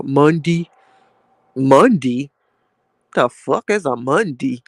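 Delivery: put-on "Monday" said as "Mundi" in a Baltimore accent; imitating friends from Baltimore saying "Monday". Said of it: "as they say out in, uh, Baltimore".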